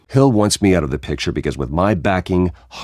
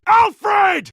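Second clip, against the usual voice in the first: anguished shout. Acted. Bruce calling out for Alfred in the cave, anguished shout. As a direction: yell the name, desperate for an answer.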